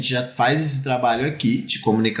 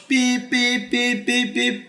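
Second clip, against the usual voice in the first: censor beep; 9 semitones higher, much slower than usual. Retold or imitated. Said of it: "ele falou um monte".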